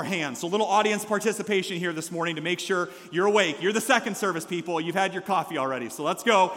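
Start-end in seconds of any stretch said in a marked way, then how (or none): none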